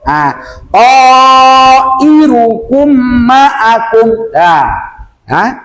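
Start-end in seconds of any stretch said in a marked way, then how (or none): 0.7-4.1 TO held long, then IRUKUM MA'AKUM in measured chant; correct recitation. Shown as a demonstration. Teacher models the correct reading, slow recitation. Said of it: "Gitu bacanya ya".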